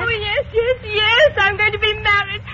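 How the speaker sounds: high, breathless, crying with happiness; overflowing happy tears; tearful joy. Acted. Bride telling her friend of the wedding, happy tears.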